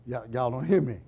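ordinary delivery

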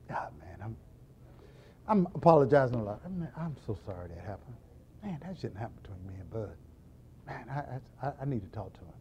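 sheepish, barely audible; hushed remorse. Retold or imitated. Deacon quietly regrets fighting with Bud, soft remorseful mumble.

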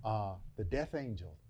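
low, soft preacher's storytelling; quiet sermon teaching. Acted. Preacher softly setting up the Passover story. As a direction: draw them in quietly before the point.

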